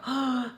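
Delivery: mock gasp of shock; sharp acted intake of breath. Shown as a demonstration. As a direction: pretend to be scandalized with a gasp. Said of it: "that wasn't like a".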